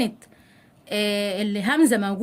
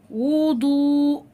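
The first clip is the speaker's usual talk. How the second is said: slow, held final vowel; drawn-out model word; 3 semitones higher, much slower than usual. Shown as a demonstration. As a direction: stretch the example word for pupils. Teacher models example word. (نطق ممدود للكلمة)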